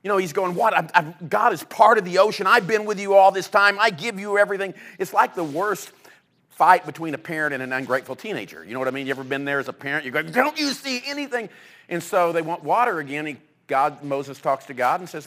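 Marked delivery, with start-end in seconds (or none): 0.5-4.7 louder, higher exasperated outburst at the people; angry Moses. Retold or imitated. Moses scolding his thirsty, complaining people, exasperated and loud. 10.2-11.5 much higher, incredulous parental cry; exasperated parent. Retold or imitated. Parent scolding an ungrateful teenager, high and exasperated.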